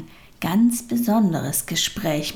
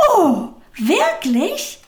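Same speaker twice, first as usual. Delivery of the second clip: amazed; 9 semitones higher, 4 dB louder, much slower than usual. A narrator's reading. Little soul astonished by the offer.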